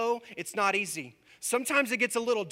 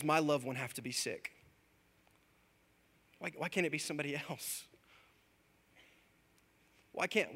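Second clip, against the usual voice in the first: near tears; 3 semitones lower, 5 dB quieter than usual. Retold or imitated. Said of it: "trying to hold back the tears".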